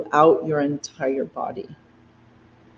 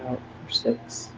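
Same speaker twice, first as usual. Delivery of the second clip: breathy voiceless count, much softer; whispered count; 3 semitones lower, 12 dB quieter than usual. Shown as a demonstration. Teacher counting students' exhale, soft whispered count.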